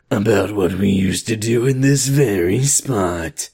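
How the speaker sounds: slow, put-on sultry voice; seducing voice. Narrator seducing Ricky on the floor, mock seductive. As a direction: draw it out as a hammed-up seduction. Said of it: "I say in a seducing voice".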